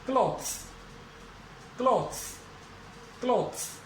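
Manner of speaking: क्लॉथ्स with a buzzing z at the end; clothes pronunciation demo. Shown as a demonstration. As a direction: model क्लॉथ्स ending in z. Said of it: "अब इसमें एस और जोड़ दिया हमने". (क्लॉथ्स को z ध्वनि के साथ बोलना)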